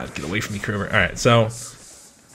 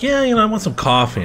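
quoting manager; 11 semitones higher, 3 dB louder than usual. Retold or imitated. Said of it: "my manager's like".

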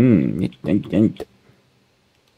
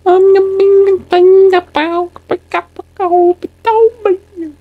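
very high, slow squeaky voice; squeaky silly voice; 4 dB louder, slower than usual. Pretend.

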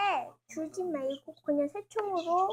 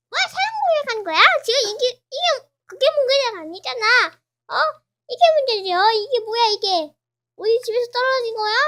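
fast, high, loud accusing; loud furious accusation; heated argument; 6 semitones higher, 11 dB louder, faster than usual. Acted. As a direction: confront them angrily. Heated argument between characters.

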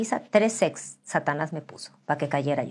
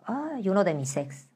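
ordinary talk

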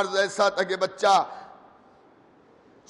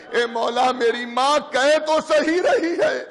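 put-on a higher, sobbing boy's voice pleading with his master; crying son's plea; 5 semitones higher than usual. A narrator's reading. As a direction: plead through tears like a sick young son. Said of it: "تو رونے لگتا ہے اور رو کے".

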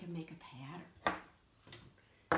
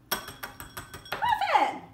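high, loud sharp call to the cat; scolding shout; 13 dB louder than usual. Real, not acted. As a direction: yell the cat's name to stop her. Cat eating the pretzels.

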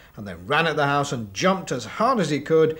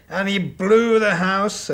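loud blowing; 6 semitones higher, 8 dB louder, slower than usual. Acted. Storyteller acts out wolf blowing.

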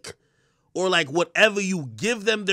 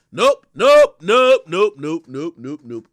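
fading nopes; 3 semitones lower, 6 dB louder, slower than usual. Real, not acted. Repeated nope fading.

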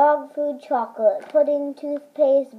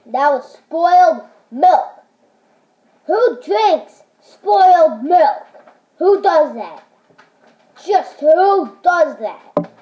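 disgusted outrage; 4 semitones higher, 8 dB louder, slower than usual. Real, not acted. Outraged at spoiled milk taste.

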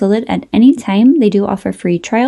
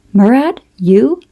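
slow, higher, louder amazed gasp of a name; gasping surprise; 4 semitones higher, 4 dB louder than usual. Acted. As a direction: catch your breath, then the name in disbelief. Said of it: "so that he gasped".

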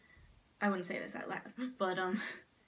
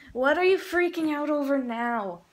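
raised, higher, exasperated call; yelling at the dog; 6 semitones higher, 9 dB louder than usual. Real, not acted. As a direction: yell over at a fussing dog. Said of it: "let's go yell at her".

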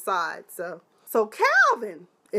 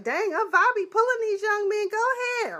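high, excited hype for Aunt Vi; hyped cheer; 11 semitones higher than usual. Real, not acted. Vlogger hyping Aunt Vi pulling young men, high and excited. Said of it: "I was like".

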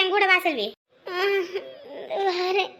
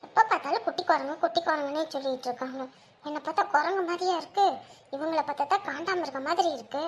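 quiet grumble; 6 dB quieter than usual. Acted. Grumbling so elder won't hear.

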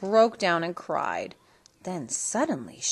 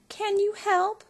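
pleading; 13 semitones higher than usual. A narrator's reading. Cindy pleading.

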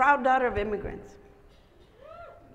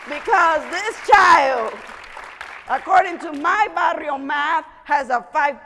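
emphatic championing; 4 semitones higher, 8 dB louder than usual. Real, not acted. Championing the student to audience.

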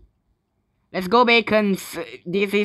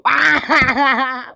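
shouted, high cackling laugh; loud victory laugh; 5 semitones higher, 5 dB louder than usual. Real, not acted. Player celebrating a win, laughing loudly. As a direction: burst out laughing loudly in triumph.

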